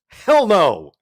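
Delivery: loud, high outburst of rejection; emphatic refusal. Real, not acted. Reviewer asked to recommend the movie, emphatically refusing. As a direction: belt out a real, emphatic no.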